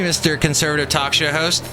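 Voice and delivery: ordinary talk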